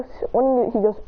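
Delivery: ordinary delivery, nothing marked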